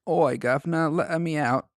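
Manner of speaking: mock Australian accent. Friend jokingly voicing the Aussie doll in a mock Australian accent. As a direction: play a bad Australian accent for a laugh. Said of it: "those damn Aussies".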